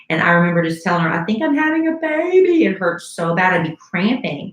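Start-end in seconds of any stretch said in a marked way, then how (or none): 1.1-2.7 high-pitched mock wail; hammed-up tearful cry, pitched way up; imitated crying child. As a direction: whine it like a kid in pain. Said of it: "squeeze her hand and just cry".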